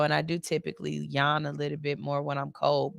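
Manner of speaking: ordinary talk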